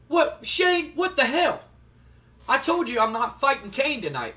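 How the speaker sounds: retold high, loud protest; indignant Owen confronting boss. Wrestler storming into boss's office, indignant. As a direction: storm in complaining, in a mock-angry voice.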